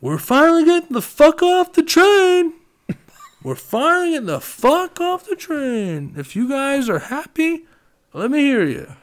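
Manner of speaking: put-on rally the whole train car like a drunk party host; drunk hype-man yell. Drunk friend announcing to a train full of kids, high drunken yell. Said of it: "He goes".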